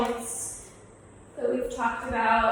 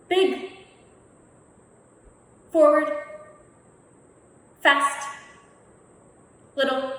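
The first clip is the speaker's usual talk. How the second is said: calling out commands; 2 semitones higher than usual. Acted. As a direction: call each move out to the room like a game leader.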